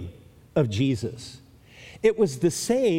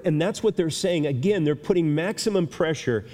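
ordinary talk

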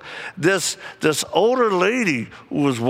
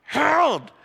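mock yell; 10 semitones higher than usual. Lady in the men's room calling her husband, high yell. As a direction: call the name out like a frantic wife. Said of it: "And she goes".

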